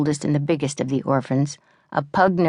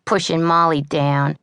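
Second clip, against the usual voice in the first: usual speaking voice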